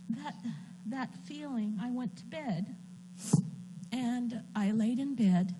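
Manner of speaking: fighting tears, quietly; tearful. Real, not acted. Recalling the night before surgery, tearful and soft. Emotional at bedtime memory.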